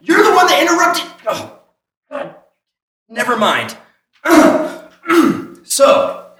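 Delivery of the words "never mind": ordinary talk, nothing marked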